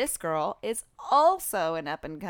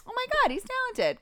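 mock gush; faster than usual. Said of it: "I'd be like".

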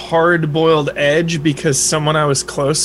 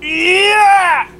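high, drawn-out scream; sudden scream; 14 semitones higher than usual. Real, not acted. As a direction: let out an abrupt high-pitched scream. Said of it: "Oh, you just screamed".

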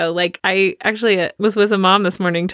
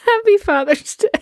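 much higher, laughing through it; laughing quip; 11 semitones higher than usual. Real, not acted. Cohost joking about dad getting book, laughing. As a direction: toss it off mid-laugh.

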